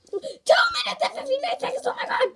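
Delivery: high, loud frantic shriek; panicked laughing shout. Real, not acted. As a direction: scream the countdown half laughing. Screaming time left.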